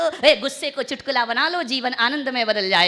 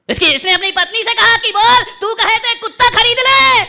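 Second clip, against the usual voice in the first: high, very loud shouted delivery; full-force storytelling; 11 semitones higher, 13 dB louder than usual. Acted. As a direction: bellow the husband's offer to the crowd. Preacher shouts husband's question.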